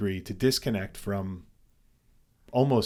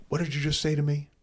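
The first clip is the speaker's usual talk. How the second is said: higher, lightly voiced challenge; confrontational stranger; 6 semitones higher, faster than usual. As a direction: imitate a driver picking a fight. Acts the confronting driver.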